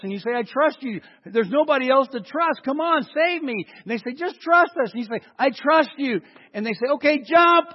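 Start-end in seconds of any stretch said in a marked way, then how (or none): 0.3-3.6 desperate pleading. Acted. Trapped man begging firemen. 4.1-4.9 calling up. Acted. Firemen calling up to window. 5.4-6.2 desperate call. Acted. Trapped man calling down. 6.9-7.7 high, loud call upward; calling up to the window. Acted.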